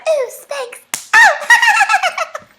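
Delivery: high, loud, laughing shriek; mock excited shriek. To viewers, misreading Spanx, a high laughing shriek. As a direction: squeal it out like a shocked fan.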